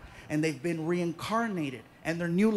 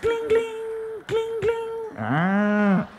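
cow bell jingle; 13 semitones higher, 5 dB louder, much slower than usual. Acted. Cows' bells while crossing.

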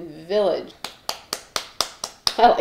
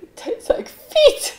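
much higher, slow whispered squeak; Ross quote; 3 dB louder, slower than usual. Retold or imitated. Quoting Ross about the truffle, high squeaky whisper. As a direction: squeak it out in disgust. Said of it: "He's like".

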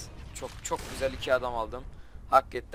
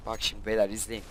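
usual speaking voice

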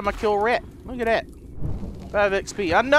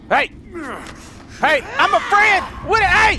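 calling out; 9 semitones higher, 3 dB louder than usual. Real, not acted. Calling to lady.

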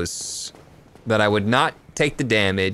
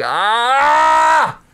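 sudden high angry shout; high frustrated scream; frustrated yell; 13 semitones higher, 6 dB louder than usual. Real, not acted. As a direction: let out a rage yell. Raging at the menu system.

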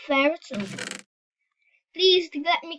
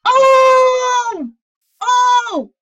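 loud high shouted oh; excited shout; 10 semitones higher, 11 dB louder than usual. Real, not acted. Gamer shouting with delight at his own play. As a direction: yell it out in delight.

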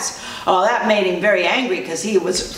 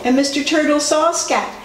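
plain reading voice

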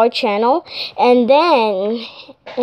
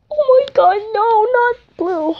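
mock crying; 8 semitones higher than usual. Operator hearing the puppy died, mock wailing. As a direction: cry out over the dead puppy.

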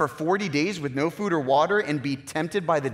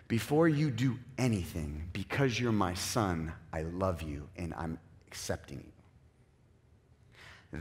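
much softer, slow and low; tender fatherly reassurance; 8 semitones lower, 9 dB quieter, slower than usual. Retold or imitated. God the Father assuring his son of love before anything, tender and soft. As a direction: hush down to a gentle promise of love.

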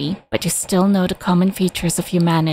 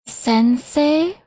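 put-on faint surprised young girl; a dying girl's faint question; weak girl voice; 8 semitones higher than usual. Dying girl recognizes him.

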